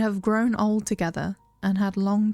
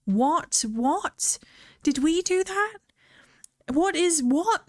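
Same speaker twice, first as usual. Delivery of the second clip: higher, repeated stunned what; shocked disbelief; 9 semitones higher, slower than usual. Real, not acted. Reacting to a game twist with stunned confusion. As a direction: sputter in confusion.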